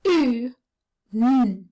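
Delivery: put-on sound-by-sound phonics for young children. Shown as a demonstration. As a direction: isolate each phoneme clearly. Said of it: "which will be J".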